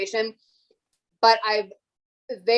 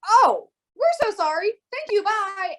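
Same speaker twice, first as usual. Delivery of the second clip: high, loud mock-embarrassed caller; flustered apology; 11 semitones higher, 5 dB louder than usual. Imitates flustered caller apologizing, hanging up.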